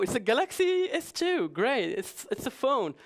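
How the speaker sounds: usual speaking voice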